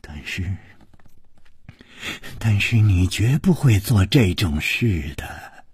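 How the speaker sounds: low, hushed, hesitant husky voice; subdued, halting plea for denial; hoarse. Acted. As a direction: falter quietly, voice gone hoarse. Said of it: "哑着声音说".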